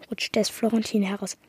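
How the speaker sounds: ordinary delivery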